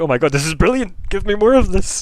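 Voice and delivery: high, loud, elated exclamation; hammed-up delight. Game master as Edgar thrilled by the glow, exaggerated delight.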